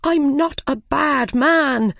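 high, drawn-out protest; crying out. A narrator's reading. Frightened Mad Hatter pleading with the King, crying out. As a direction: wail the denial, slow and high. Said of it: "the Mad Hatter cried".

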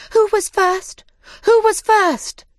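very high, loud excited demand; high-pitched shouted question, stretched on the repeat; shouting crowd. Acted. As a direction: clamour like a crowd of small animals. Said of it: "they shouted".

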